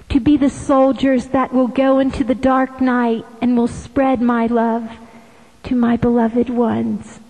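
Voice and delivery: ordinary talk, nothing marked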